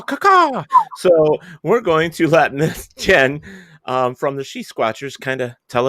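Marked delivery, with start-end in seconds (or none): 0.0-0.6 high call. Retold or imitated. Host says the story's title.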